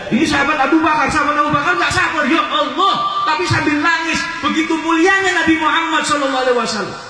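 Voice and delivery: high-pitched, full-force sermon cry; impassioned preaching. Real, not acted. Preacher telling of the weeping companion, impassioned high delivery.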